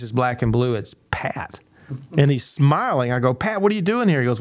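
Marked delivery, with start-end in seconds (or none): 0.7-1.6 dropped to a breathy near-whisper; hushed reveal. Retold or imitated. Reveal of the injured visitor. 3.3-4.2 pitched much higher in shock; startled. Retold or imitated. Coach shocked at the door.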